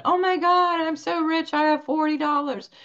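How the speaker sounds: put-on high, thrilled little-girl voice; giddy child. Daughter bragging to everyone about her money, high and giddy. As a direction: squeal it like a kid who just got rich. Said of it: "She was telling everyone".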